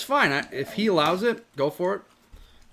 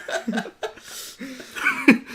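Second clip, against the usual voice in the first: silent breathless laugh; wheezing laughing fit; 8 semitones higher than usual. Real, not acted.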